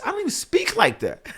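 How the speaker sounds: high-pitched, incredulous squawk with a laugh; comic high-pitched disbelief; incredulous protest. Acted. Mock protest at her paragraphs.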